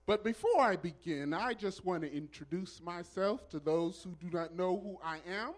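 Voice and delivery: usual speaking voice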